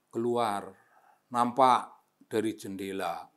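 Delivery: ordinary delivery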